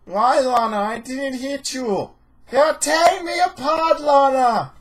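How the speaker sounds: hammed-up, high, loud accented wail; Tommy Wiseau. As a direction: overact an anguished accented outburst at the top of your voice. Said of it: "Did you watch The Room and assume Tommy Wiseau".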